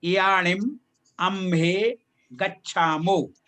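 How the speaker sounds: यानिम अम्हे गच्छामो slowly, word by word; slow model reading of यानिम अम्हे गच्छामो, long vowels held; careful pronunciation demo. Shown as a demonstration. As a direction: draw out अम्हे with a clear final ए. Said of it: "ए का होना चाहिए".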